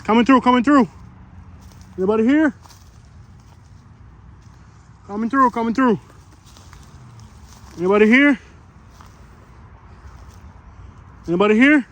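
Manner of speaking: high, loud announcing call; coming through shout. Real, not acted. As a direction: call out so nobody gets startled. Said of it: "always good to announce yourself".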